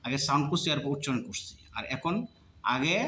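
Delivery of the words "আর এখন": ordinary talk, nothing marked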